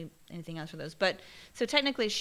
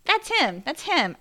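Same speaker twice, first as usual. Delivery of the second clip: high, insistent, pointing the finger; that's him, higher and louder; blaming the cat, high and insistent; emphatic blame; 6 semitones higher, 3 dB louder, slower than usual. Retold or imitated.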